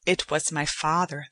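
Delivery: plain reading voice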